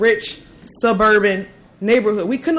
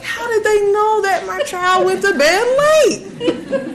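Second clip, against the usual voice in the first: laughing amazement; 12 semitones higher, slower than usual. Real, not acted. Mother amazed teachers noticed late bedtime, laughing. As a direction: laugh as if you can't believe it. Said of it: "I'm like".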